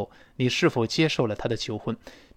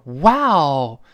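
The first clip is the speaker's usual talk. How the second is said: long, delighted exclamation; drawn-out wow of shared joy; happy for friend; 5 dB louder than usual. Acted. Best friend hearing of the proposal, happily amazed. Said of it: "当然替自己的好朋友高兴了".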